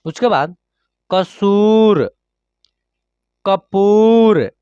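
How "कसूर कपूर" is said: ordinary talk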